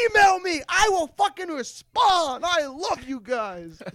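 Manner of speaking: hammed-up eager mentor voice; mock enthusiastic promise. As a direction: oversell it like a schmoozing celebrity. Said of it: "he was like".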